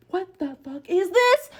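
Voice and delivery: high, slow, outraged demand; crashing out. Retold or imitated. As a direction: spell out each word in rising outrage. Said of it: "I'm crashing out".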